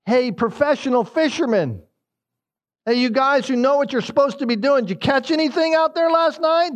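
high, mocking taunt at the experts; sarcastic ribbing of professional fishermen, pitched well up. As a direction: rub it in with high mock innocence. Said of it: "You can almost hear the sarcasm".

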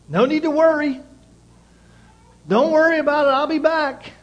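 a ringing cry of reassurance to the congregation; loud preached reassurance. Acted. Jesus's reassurance, preached aloud.

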